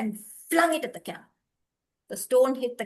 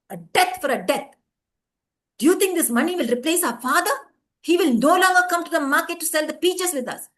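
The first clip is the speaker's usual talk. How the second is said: loud, higher indignant voice; angry refusal; 4 semitones higher, 6 dB louder than usual. A narrator's reading. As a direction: throw back the offer. Sons refuse money angrily.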